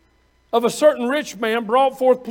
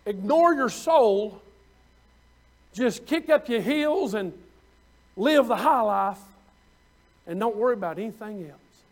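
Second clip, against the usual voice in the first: ordinary talk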